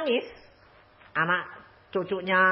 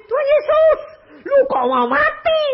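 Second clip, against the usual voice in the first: distraught cry to Jesus; 5 dB louder than usual. Retold or imitated. Uncle cries out to Jesus.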